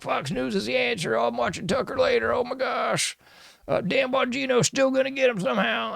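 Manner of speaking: high, airy gushing voice; mocking fan. Host mocking gullible Fox viewers, high gushing voice. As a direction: gush like a naive fan.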